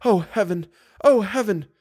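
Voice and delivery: high wailing heroine exclamation; distraught. A narrator's reading. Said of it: "with a distraught air".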